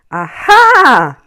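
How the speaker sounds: high, loud gleeful aha; triumphant aha. Acted. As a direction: crow with delight at finding her. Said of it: "Matthew laughed".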